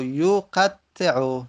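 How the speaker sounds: یقطع stretched out very slowly; slow model reading. Shown as a demonstration. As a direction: drag out یقطع for the students. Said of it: "مثال زدیم یقطع". (یقطع را خیلی آهسته و کشیده بخوان)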